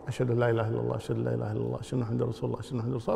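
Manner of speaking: soft, low murmur, barely audible; quiet demo of the muted testimony. Shown as a demonstration. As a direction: keep it down so only you hear it. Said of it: "بعدين يرفع صوته".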